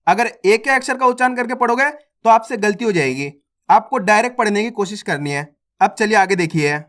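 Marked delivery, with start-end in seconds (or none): none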